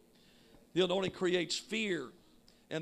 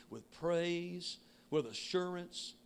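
normal talking voice

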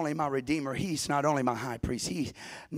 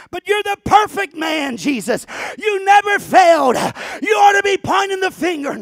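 high, loud protest to Jesus; objecting believer; 16 dB louder than usual. Pretend. Believer challenging Jesus with a loud protest. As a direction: shout the objection up at him.

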